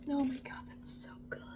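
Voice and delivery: breathless whispered delight; whispered awe. Real, not acted. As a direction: whisper it, overwhelmed by the taste. Tasting, overwhelmed.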